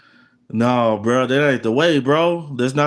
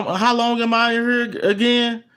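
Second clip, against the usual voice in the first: mock despair; 8 semitones higher than usual. Imagined despair in prison.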